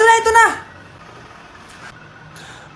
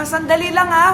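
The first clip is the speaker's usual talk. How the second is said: ordinary talk, nothing marked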